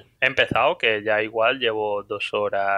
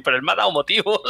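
high mock protest; 8 semitones higher, much faster than usual.

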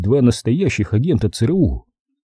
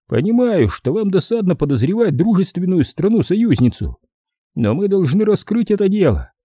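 lightly voiced official, pitched up; a touch of insistence, in a raised dialogue voice; insistent official; 8 semitones higher than usual. Official pressing allies to solve the case, lightly insistent.

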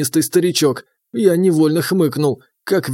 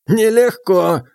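put-on higher old man, with a smirk; 4 semitones higher, slower than usual. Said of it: "хмыкнул старик".

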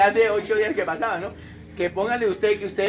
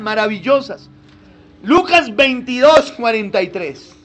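raised, fervent preacher voice; preaching; 3 semitones higher, 6 dB louder than usual. Acted. Preacher urging the church to read Luke, fervent. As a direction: proclaim it to the congregation.

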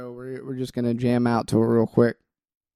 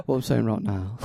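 put-on British accent, pitched up and giggling; British Gordon Ramsay voice; 4 semitones lower, 7 dB quieter than usual. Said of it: "doing your Gordon Ramsay impression".

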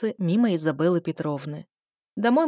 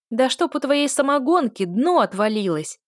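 loud shouted curse; woman shouting; 6 semitones higher, 5 dB louder than usual. A narrator's reading. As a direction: yell it back across the queue. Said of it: "прокричали ему в ответ женским". (женский крик)

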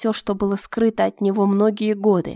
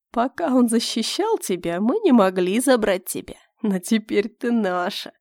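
sinister verdict. Acted. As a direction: deliver it as a final sentence. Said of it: "вынес вердикт хромой".

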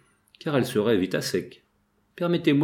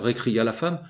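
ordinary delivery, nothing marked

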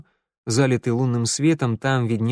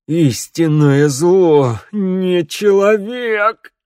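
slow croak building to loud, high cries; possessed hoarse prophecy; 4 semitones higher, 5 dB louder, much slower than usual. A narrator's reading. Vangeli prophesies destruction in agony, hoarse possessed voice. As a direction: rasp out doom, stretching every phrase. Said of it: "прохрипел он не своим голосом".

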